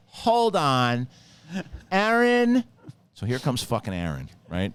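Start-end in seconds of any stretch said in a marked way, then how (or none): 0.1-2.6 high, loud call rising on the name; calling a coworker. Retold or imitated. Cashier calling Aaron over for help, loud imitated call. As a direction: imitate a clerk hollering for backup. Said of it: "she goes".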